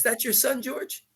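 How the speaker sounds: put-on high, light Kentucky accent; attempted Kentucky accent. Muhammad asks George about his son, imitated Kentucky accent. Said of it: "trying to do his Kentucky accent".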